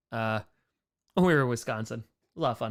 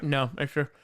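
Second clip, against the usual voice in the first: normal talking voice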